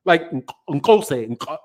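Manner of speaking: "Nkose" tried slowly with a click; attempted click sound. Shown as a demonstration. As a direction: stumble at a click inside "Nkose". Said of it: "I can't do that shit".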